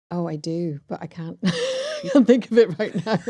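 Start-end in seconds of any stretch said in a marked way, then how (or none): 1.7-3.3 flustered, higher and louder through laughter; real giggly laugh while talking. Real, not acted. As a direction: laugh helplessly at your own blank. Flustered, laughing at own blank.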